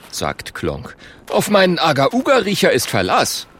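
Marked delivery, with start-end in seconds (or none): 1.3-3.5 lightly voiced cocky caveman; higher-pitched, punchy boast; boastful caveman. Klonk vouches for his own nose, boastful.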